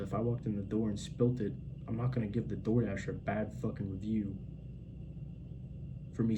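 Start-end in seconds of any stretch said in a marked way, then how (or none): none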